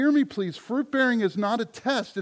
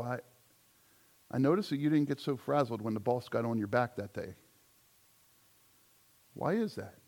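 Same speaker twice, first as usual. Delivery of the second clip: soft retold dialogue; 10 semitones lower, 6 dB quieter than usual. Voicing coworker and believer exchange.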